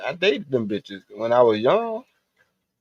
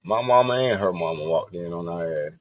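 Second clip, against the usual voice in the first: usual speaking voice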